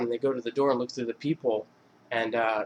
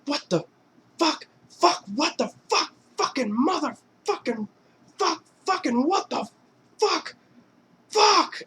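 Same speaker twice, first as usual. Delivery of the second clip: high, rising cursing tirade; furious cursing; slower than usual. Retold or imitated. Rocco realizes it was his friends, high escalating curses. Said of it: "he's like".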